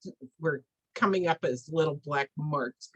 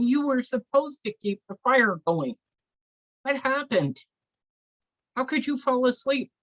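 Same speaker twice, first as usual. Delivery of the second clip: higher-pitched, indignant scolding; scolding medicine man; 8 semitones higher, 3 dB louder than usual. Acted. Medicine man wakes the sleeping helper, scolding. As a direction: act out an exasperated telling-off.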